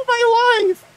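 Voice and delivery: high, loud laughing cheer; joyful laugh. Real, not acted. Relief at finding exit.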